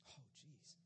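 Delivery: whispered dismay; stunned whisper. Acted. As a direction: breathe it out, deflated. Reacting to Jesus's prediction to Peter.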